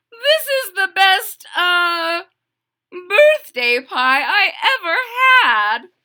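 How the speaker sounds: high loud tender mother voice; mother's voice. Acted. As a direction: mum thanks her son. Mother moved.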